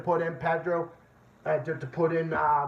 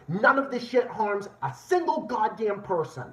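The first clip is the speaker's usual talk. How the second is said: ordinary talk, nothing marked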